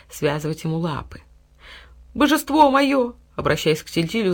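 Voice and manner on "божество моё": high, slow pleading; pleading dog. A narrator's reading. Dog begging his master for help. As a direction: beg your master. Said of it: "взмолился пёс".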